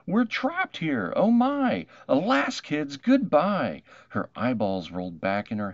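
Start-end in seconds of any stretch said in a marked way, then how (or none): 0.1-3.8 high, loud terrified wail, slowing to a dramatic goodbye; a teacher shrieking in hammed-up panic; panicked scream. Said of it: "Miss Lila Greer started to scream".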